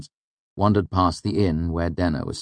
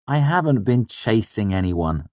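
defensive denial, higher voice; protesting; 7 semitones higher, 3 dB louder than usual. A narrator's reading. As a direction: push back against the teasing. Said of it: "I protested".

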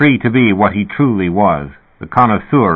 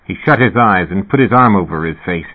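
ordinary delivery

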